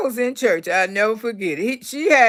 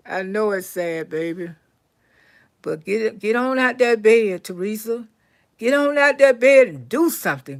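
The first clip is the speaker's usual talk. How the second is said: ordinary delivery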